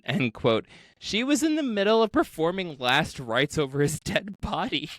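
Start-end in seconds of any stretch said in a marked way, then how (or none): none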